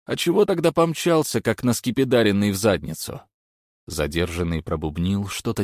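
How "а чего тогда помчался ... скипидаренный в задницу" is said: higher, louder taunt; mocking. A narrator's reading. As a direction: tease him for running. Krichko mocking the runner.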